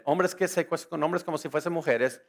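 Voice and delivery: normal talking voice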